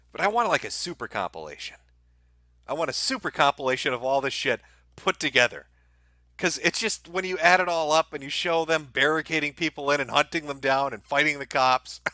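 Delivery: ordinary talk, nothing marked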